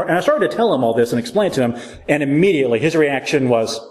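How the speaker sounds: ordinary talk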